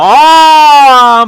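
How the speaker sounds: sudden high yelp of shock, loud; a sharp real cry of surprise; startled yell. Real, not acted. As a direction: yelp as the car is hit. Car gets taken out.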